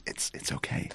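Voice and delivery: silent mouthed whisper. Acted. Erica mouthing comfort to you in a whisper. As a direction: breathe it without voice, just mouthing. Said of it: "mouths to you".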